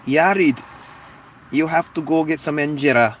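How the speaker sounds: put-on Ethiopian accent. Telling Yarid to get injera, mock Ethiopian accent. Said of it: "That's my Ethiopian accent".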